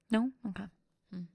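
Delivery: ordinary talk, nothing marked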